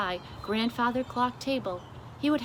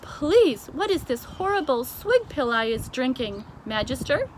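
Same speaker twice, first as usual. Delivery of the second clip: BFG disgusted question; 5 semitones higher, 5 dB louder than usual. A narrator's reading. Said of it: "he cried".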